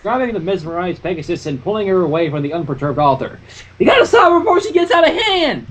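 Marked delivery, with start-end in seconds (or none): 3.8-5.7 high hurried urgency; urgent Applejack. A narrator's reading. Applejack urges Rainbow to stop Twilight, high. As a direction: push it out fast and worried. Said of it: "Applejack said quickly".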